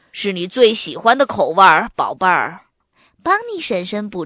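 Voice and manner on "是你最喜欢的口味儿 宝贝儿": lightly voiced dad, louder and hearty; fond father, dropping low on the endearment; 笑着的爸爸. A narrator's reading. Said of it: "爸爸笑着说". (爸爸笑着亲切地说)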